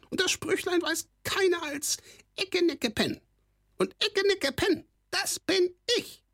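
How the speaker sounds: very high, gloating goblin cry; dance and crow like a triumphant goblin; a gloating goblin shrieking in triumph; gleeful kobold squawk. A narrator's reading. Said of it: "schrie dabei mit seiner Quäkstimme".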